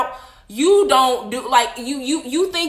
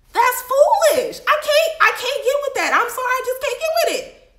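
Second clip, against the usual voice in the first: mock disgust; 11 semitones higher than usual. Mock disgust, very high, slow.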